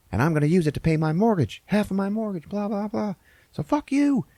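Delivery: rising, high-pitched indignant retort; mock defiant telling-off. Waitress refusing to give back her tip, mock defiant. Retold waitress tells him off.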